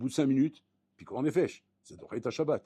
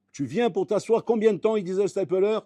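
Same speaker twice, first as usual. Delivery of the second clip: rising preaching; 7 semitones higher, 7 dB louder than usual. Real, not acted. Sermon monte en intensité.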